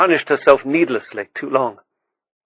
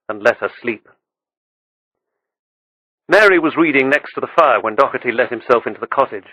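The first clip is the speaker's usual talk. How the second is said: ordinary delivery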